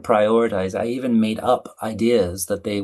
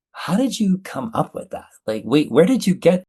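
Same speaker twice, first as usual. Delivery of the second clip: higher-pitched puzzled questioning; baffled room reaction; 8 semitones higher than usual. Retold or imitated. A room reacting to a sudden answer, baffled. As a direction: voice a room caught off guard. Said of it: "everybody in the room is like".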